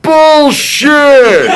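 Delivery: high, loud yell of disbelief; imitated heckler's shout; screamed heckle. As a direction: holler it from the back of a hall. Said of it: "someone else screams out".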